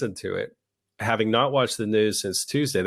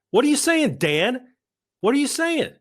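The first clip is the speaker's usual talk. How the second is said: much higher, exasperated and pleading; incredulous high squeal. Real, not acted. Host demanding answers from Dan, in a high exasperated squeal. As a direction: squeal it at him in disbelief.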